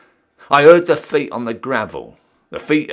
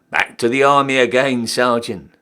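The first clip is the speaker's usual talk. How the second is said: ordinary delivery, nothing marked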